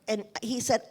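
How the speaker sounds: normal talking voice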